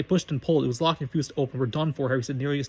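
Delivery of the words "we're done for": usual speaking voice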